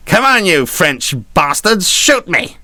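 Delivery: high, slow, drawn-out battle challenge; hammed-up daring call to the enemy; defiant taunt. Captain taunting French gunners from the quarterdeck, defiant call.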